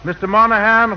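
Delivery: usual speaking voice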